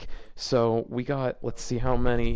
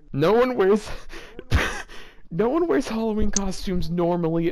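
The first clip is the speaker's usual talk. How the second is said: louder, higher disbelieving rant; incredulous outburst; 7 semitones higher, 4 dB louder than usual. Real, not acted. Reader ranting at the fanfic's costume line, incredulous. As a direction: protest it, half laughing.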